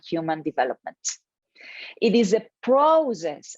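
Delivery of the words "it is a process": "process" punched higher and louder; stress on process. Real, not acted. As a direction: lean firmly on the word "process". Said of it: "I would emphasize the word process here".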